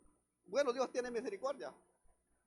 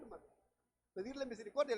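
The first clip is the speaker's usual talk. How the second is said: ordinary talk, nothing marked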